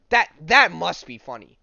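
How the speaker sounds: loud, high laughing outburst; loud delighted exclaim; loud amused exclaim. Real, not acted. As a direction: burst out at how funny it is. Amused at the comic scene.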